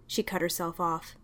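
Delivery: usual speaking voice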